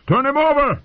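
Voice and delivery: high, loud bellow; bellowed order. Acted. Mace Tyrell bellows at everyone and no one. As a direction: shout orders into the chaos. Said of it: "Mace Tyrell bellowed".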